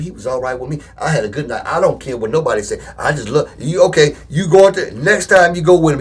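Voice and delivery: ordinary delivery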